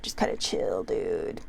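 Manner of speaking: lazy chill surfer impression; surfer dude voice. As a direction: slacker surfer voice, way down low. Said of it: "voice from, uh, Sean Penn's character".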